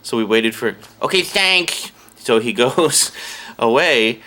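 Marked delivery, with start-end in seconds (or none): 1.0-1.9 high sci-fi nerd voice; geeky voice. Retold or imitated. Customer asking where the science fiction section is, in geeky nerd voice. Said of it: "had the voice".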